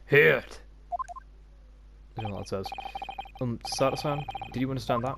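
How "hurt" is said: loud, higher Russian grunt; Russian bark. Acted. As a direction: bark a refusal in a Russian accent. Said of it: "I like to do Russian accents".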